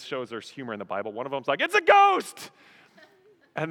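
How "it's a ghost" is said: high, loud comic shriek; hammed-up terrified cry; mock panic. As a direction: yell it like a spooked disciple. Acting a frightened disciple, for humor.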